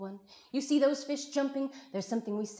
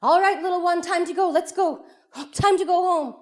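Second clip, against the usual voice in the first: high, loud summoning call; 10 semitones higher, 12 dB louder than usual. Acted. Mama calling her little one home, loud high call. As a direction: project it far down the beach. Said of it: "the mama calls out".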